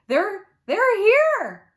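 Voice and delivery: high, slow, stretched-out yelp of excitement; excited yelp. A narrator's reading. Girl to Mommy as Poppy's horn honks, yelping with excitement. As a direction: squeal it out, bursting with excitement. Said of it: "I yelped".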